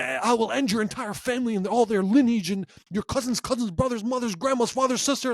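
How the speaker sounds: high, ranting mock threat. A podcaster mocking an angry parking-lot threat, high ranting. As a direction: rattle off the relatives in a high rant. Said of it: "the most next things".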